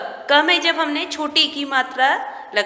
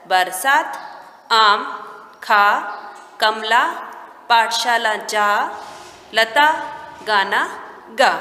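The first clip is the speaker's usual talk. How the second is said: low, measured, one word at a time; teacher dictating to beginners; word-list reading; 3 semitones lower, slower than usual. Shown as a demonstration. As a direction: leave a clear pause after each word.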